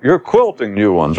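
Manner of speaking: ordinary talk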